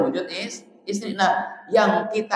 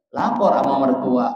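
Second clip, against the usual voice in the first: ordinary talk, nothing marked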